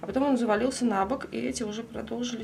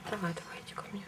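coaxing whisper; 10 dB quieter, faster than usual. Real, not acted. Coaxing the lizard off clutch.

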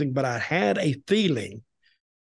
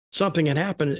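normal talking voice

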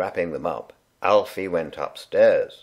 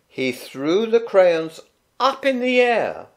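surprise build; 12 semitones higher, 4 dB louder than usual. A narrator's reading.

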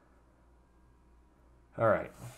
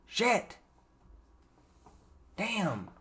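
ordinary talk, nothing marked

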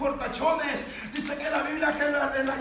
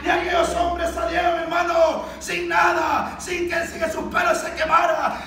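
raised, driving preaching voice; full-force preaching; 4 semitones higher, 6 dB louder than usual. Real, not acted. Preacher tells how the men came out unburned, full-force preaching. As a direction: proclaim the miracle with force.

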